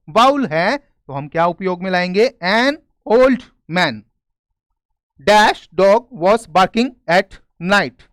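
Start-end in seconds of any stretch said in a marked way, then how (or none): 2.4-3.9 very slow, word by word; slow dictation. Shown as a demonstration. Reading the answer aloud. 5.3-8.0 very slow, word by word; slow dictation. Shown as a demonstration. Reading a fill-in sentence aloud.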